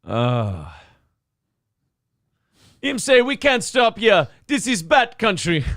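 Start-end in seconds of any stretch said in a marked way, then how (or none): 2.8-5.6 high-pitched Jamaican patter; Jamaican accent. Acted. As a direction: put on a comic Jamaican accent. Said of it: "Him's going to Jamaica".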